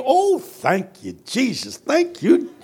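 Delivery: high, drawn-out thanks, slowly wailed; grandmother's joyful praise. Retold or imitated. Grandmothers hearing a student's news, high joyful praise. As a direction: imitate an overjoyed church grandmother.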